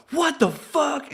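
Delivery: jolted wide awake; stretched-out stunned yell; high-on-coke shock. Retold or imitated.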